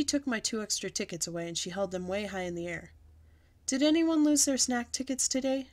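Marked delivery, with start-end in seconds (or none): 3.7-5.7 teacher addressing class. A narrator's reading. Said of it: "she said to the class".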